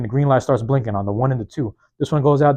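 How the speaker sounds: ordinary delivery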